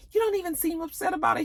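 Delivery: put-on high quick girly voice; girly classmate. Classmate accusing Tariq, high quick voice.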